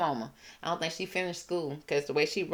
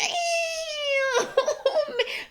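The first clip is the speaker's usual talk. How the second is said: high squealing burst of laughter; shrill laughing cackle. Real, not acted. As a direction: cackle in delight at the burn. Laughing at the insult.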